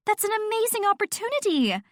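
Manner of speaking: very high, thrilled gushing; squealing delight. A narrator's reading. Friend celebrates Jen's opportunity, squealing. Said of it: "Nat squealed and hugged me so hard".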